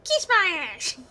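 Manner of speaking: playful rude retort; cheeky sass. Pretend. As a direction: fling it out with mock attitude. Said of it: "I'm like".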